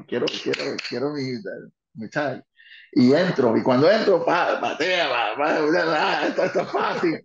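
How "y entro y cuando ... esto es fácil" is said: louder, thrilled, laughing at the end; excited loud telling. Real, not acted. Wrestler telling the host his ring entry, loud and gleeful.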